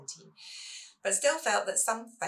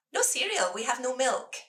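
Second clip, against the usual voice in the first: high lilting sing-song, louder; 6 semitones higher, 5 dB louder than usual. Retold or imitated. Mum sings the no-milk news to her children. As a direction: sing it out to soften the bad news. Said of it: "I try in a sing-song voice".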